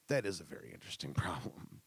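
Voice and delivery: whispered aside breaking into a laugh. Real, not acted. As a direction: mutter it, cracking up. Comic aside after caller story.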